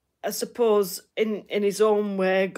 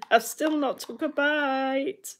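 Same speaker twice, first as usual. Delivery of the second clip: higher, slow, mock-plaintive whine; playful slow whinge about her uneaten breakfast; 5 semitones higher than usual. Repeats the complaint mock-plaintively.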